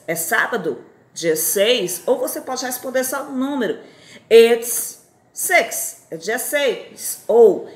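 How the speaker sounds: ordinary talk, nothing marked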